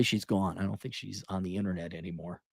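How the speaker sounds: usual speaking voice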